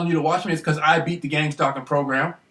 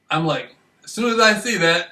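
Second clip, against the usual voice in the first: normal talking voice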